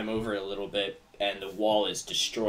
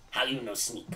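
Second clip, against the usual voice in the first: suspicious goblin; 2 semitones higher than usual. Acted. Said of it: "he goes".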